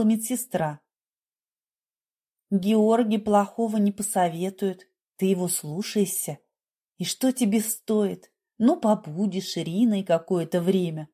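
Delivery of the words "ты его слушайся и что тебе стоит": plain reading voice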